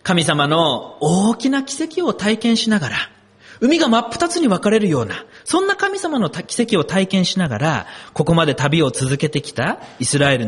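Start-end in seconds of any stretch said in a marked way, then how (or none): none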